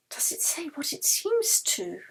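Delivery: breathy hushed question, barely voiced; frightened whisper. Yeli whispering to Anna over the glass, afraid. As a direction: whisper it, afraid to hear the answer.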